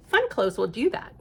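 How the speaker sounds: usual speaking voice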